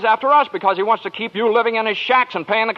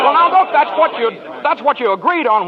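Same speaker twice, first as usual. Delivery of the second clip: sudden raised protest; 6 semitones higher than usual. Acted. Arguing with a worried depositor.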